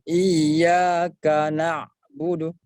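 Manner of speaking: slow "iyyaka na'budu" with the ain cut off; over-thick, fully stopped ain; wrong reading, heavy. Shown as a demonstration. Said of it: "Tidak boleh terputus".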